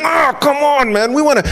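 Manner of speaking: high-pitched whining protest; mock exasperation. Egyptians stuck with jammed chariot wheels, exasperated. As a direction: complain like someone thwarted mid-chase.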